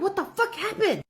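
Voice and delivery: high, urgent retold panic; panicked yell. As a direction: demand an answer in a panic. Panicked question to friend.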